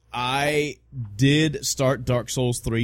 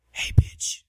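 mysterious voice. Retold or imitated. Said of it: "I kept hearing this voice".

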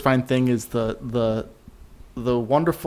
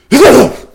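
fake sneeze sound; 13 semitones higher, 13 dB louder than usual. Shown as a demonstration. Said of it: "It's like a".

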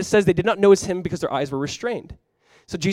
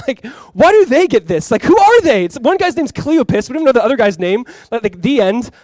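loud, high-pitched disbelief; incredulous outburst; 7 semitones higher, 8 dB louder than usual. Real, not acted. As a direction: exclaim it, baffled and indignant. Mock-indignant aside about the two men.

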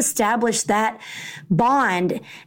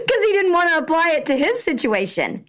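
laughing through the words, higher; 7 semitones higher than usual. Real, not acted. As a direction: giggle as you explain. Laughing at son's answer.

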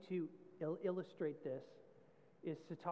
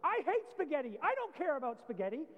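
put-on higher, louder childish tantrum; kid's outburst; 6 semitones higher, 6 dB louder than usual. A pastor voicing a sulky child denied a cookie, mock tantrum shout. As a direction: shout it like a five-year-old refusing supper. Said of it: "say absolutely outrageous things like".

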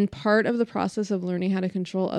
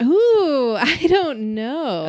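laughing through the words, slowly; 7 semitones higher, 7 dB louder, much slower than usual. Real, not acted. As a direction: chuckle it out, caught off guard. Laughing at an unexpected question.

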